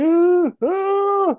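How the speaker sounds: high-pitched mock whiny wail; mock whining. Mocking the character crying over an eye scan, exaggerated whining. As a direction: whimper it loudly, overdoing the whine. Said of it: "a lot of whining".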